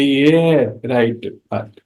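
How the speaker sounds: slow, deliberate, each word weighed; slow emphasis. Acted. Teacher slowly stating the correct way to put it, deliberate. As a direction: lay it down slowly as the right answer.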